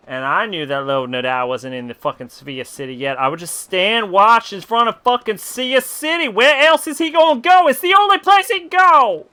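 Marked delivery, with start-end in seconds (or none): 3.2-9.3 real shout, rising much higher and louder. Real, not acted. Streamer ranting about where the character must go, shouting. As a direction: build from firm to a full yell. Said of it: "That got really loud".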